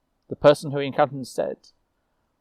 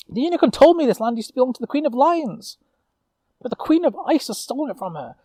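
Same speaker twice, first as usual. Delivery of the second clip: much higher, eager and rising; impassioned young witch; 11 semitones higher, 4 dB louder than usual. Acted. Young witch urging her fellow knight to fight for the land, impassioned. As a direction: plead with growing fervour. Lucille pleads her case.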